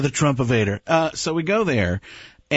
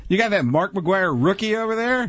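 put-on high excited kid asking for a card; a high kid's voice, eager for a baseball card; 7 semitones higher than usual. Young Roy at the station, voiced.

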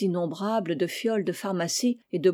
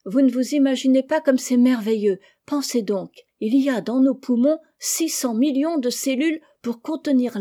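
higher, louder, eager marvelling; enthusiastic wonder; 5 semitones higher, 5 dB louder than usual. A narrator's reading. Niece telling her aunt about the lungs, enthusiastic. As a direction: share an amazing discovery with gusto.

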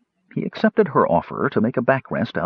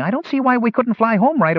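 much higher, reedy little gnome complaining; 13 semitones higher than usual. Acted. A gnome grumbling to his friends, high and put-upon. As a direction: pitch up into a small gnome grumbling about going home.

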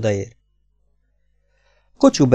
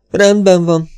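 higher and louder, grudging and angry; 8 semitones higher, 6 dB louder, slower than usual. Acted. Kocsubej gives in to the stingy Americans, angry. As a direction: spit out the consent in anger. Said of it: "hunyorgott dühösen".

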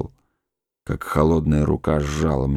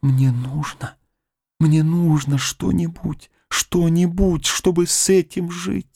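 rising, pleading higher and higher; cling to every word as she begs; desperate plea; 10 semitones higher than usual. Acted. Woman begs for something to live with, climbing desperate plea.

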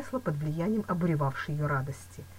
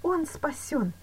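raised, elated cry of good news; joyful exclamation; 11 semitones higher, much slower than usual. A narrator's reading. Woman overjoyed her beloved is saved, exclaiming. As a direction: exclaim it with relief. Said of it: "воскликнула она".

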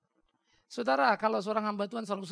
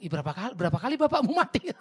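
incredulous retort; 3 semitones higher, 8 dB louder than usual. Retold or imitated. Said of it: "Hanya teman saya bilang".

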